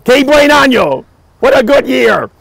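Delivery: high and loud, mock-grand celebration; playful toast. Guest toasts the party after splashing himself with wine, cheerful. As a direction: toast it like a fine vintage.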